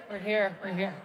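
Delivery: ordinary talk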